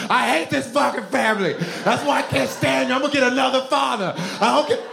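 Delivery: high-pitched hammed-up tantrum; mock scream. Child yelling at family during beating, mock scream. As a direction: scream it like a kid being whooped. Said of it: "I would scream".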